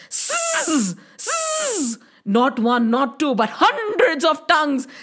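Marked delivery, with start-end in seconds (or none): 0.1-2.0 long buzzing serpent hiss; snake hiss. A narrator's reading. Narrator imitates the tongue of the snake, hissing. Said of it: "the tongue of the snake went".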